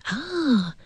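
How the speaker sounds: « Ah ! » aigu et étiré; déclic surpris rejoué. Retold or imitated. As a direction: feindre une découverte soudaine, voix qui monte. Thérapeute réagit à la réponse de l'ado. (exclamation de surprise rejouée)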